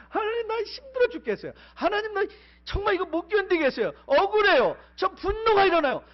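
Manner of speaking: high, loud crying out to God; a desperate, pleading cry to God; anguished plea. Retold or imitated. 이런 마음이 아니라.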